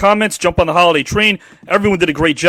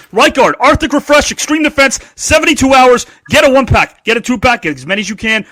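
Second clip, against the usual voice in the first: ad read; 5 semitones higher, 3 dB louder than usual. Acted.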